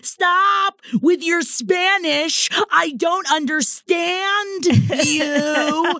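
high, slow, shouted hammed-up fit; mock screaming tantrum. Kelly yelling at the moms to stop speaking Spanish, mock scream. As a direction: shriek it like a spoiled tantrum. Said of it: "she's doing the blah, blah, blah, like, hand motion".

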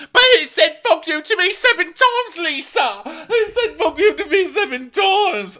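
very high, loud mock wail; hammed-up crying complaint. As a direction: wail the grievance like a hysterical tattletale. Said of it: "immediately starts crying".

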